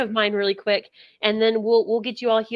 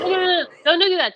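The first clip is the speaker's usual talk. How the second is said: louder, higher, urgent protest; alarmed plea; 6 semitones higher, 6 dB louder, faster than usual. Acted. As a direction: jump in quickly to stop a kick. Urgently stopping horse from kicking.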